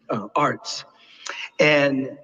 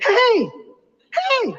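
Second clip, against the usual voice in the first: calling out. Retold or imitated. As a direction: call out to get a big man's attention. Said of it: "this voice went".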